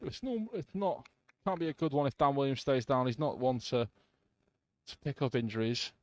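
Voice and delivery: live football commentary; play-by-play commentary. Real, not acted. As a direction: call the match as it happens.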